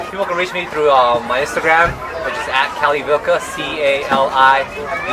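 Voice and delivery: ordinary talk, nothing marked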